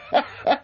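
put-on very high old man's laugh; gleeful cackle. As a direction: cackle in triumph. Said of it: "Mister Crackenthorpe laughed again".

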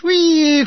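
high, loud falling shell whistle; mock shell whistle. Imitating incoming shell.

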